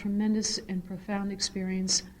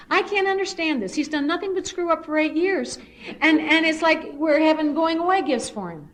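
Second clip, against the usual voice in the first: higher, louder indignant complaint; mock whiny outrage; 7 semitones higher, 6 dB louder than usual. Mother voicing angry son's jealous complaint, indignant and loud. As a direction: act out the brother's jealous griping.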